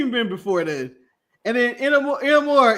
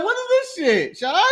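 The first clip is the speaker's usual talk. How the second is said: mock exasperation; 7 semitones higher than usual. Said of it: "I was like".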